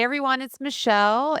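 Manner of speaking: ordinary delivery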